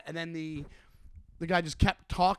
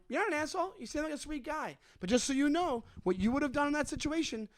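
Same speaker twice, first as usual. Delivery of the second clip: condescending; 8 semitones higher than usual. Retold or imitated. Retold put-down of heckler.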